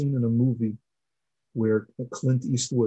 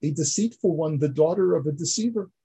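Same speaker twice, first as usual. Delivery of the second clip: higher, shocked rebuke rising to a cry; amazed accusation; 5 semitones higher than usual. Retold or imitated. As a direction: demand an answer in disbelief. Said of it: "Yaakov says in amazement".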